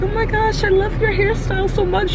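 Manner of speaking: very high gushing compliment; gushing dentist staff. Retold or imitated. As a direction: squeal it like an excited fan. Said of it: "everyone in the dentist was like".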